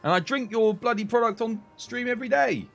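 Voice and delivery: mock outrage, rising high; aggrieved, high-pitched comic complaint; indignant retort. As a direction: protest like a snubbed loyal customer. Mock retort to refusing sponsors.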